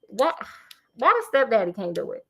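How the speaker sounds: usual speaking voice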